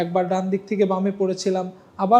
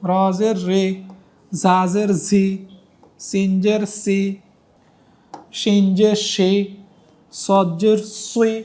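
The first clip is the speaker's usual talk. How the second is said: slow, clear letter-by-letter recitation; recitation drill; 2 semitones lower than usual. Shown as a demonstration. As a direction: model each sound for students to repeat. Teacher drills Arabic letter sounds.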